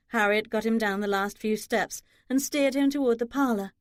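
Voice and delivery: usual speaking voice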